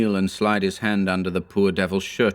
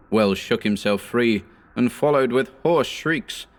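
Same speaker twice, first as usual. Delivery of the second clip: ordinary delivery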